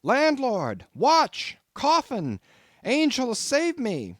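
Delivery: high panicked shout; frantic, high-pitched cry for rescue; shouted for help. A narrator's reading. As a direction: yell for the landlord in terror. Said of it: "shouted I".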